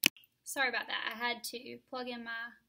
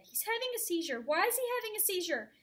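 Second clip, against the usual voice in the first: high, raised panicked question; frightened mother asking why her son is seizing; 10 semitones higher, 9 dB louder than usual. Acted. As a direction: plead for an answer in fear. Mother panics over seizure.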